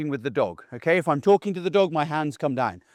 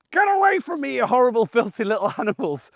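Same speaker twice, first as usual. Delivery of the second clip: mock disgusted dad; 7 semitones higher than usual. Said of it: "I'm like".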